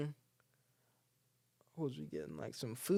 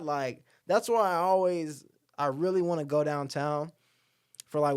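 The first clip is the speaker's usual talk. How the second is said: ordinary talk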